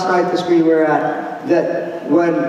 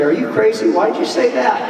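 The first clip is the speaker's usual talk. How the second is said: higher, incredulous mock scolding; exasperated; 7 semitones higher than usual. Parent challenging the other parent in the bedroom, mock exasperation. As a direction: toss it at your spouse in disbelief.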